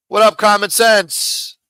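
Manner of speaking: slow, high, loud hype hello to a chatter; drawn-out greeting. Real, not acted. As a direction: stretch it out like calling to a friend across the room. Greeting a viewer in chat.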